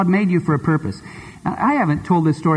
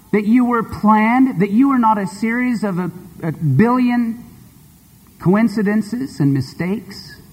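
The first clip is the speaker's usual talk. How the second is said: deliberate pulpit emphasis; emphatic preaching; 5 semitones higher than usual. Acted. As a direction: drive it home phrase by phrase. Preacher building emphatic point.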